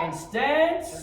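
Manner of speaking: high, explosive counting yell; drill command shout. Real, not acted. Instructor calling kicks for students, shouting. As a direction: bellow the count to drive the class.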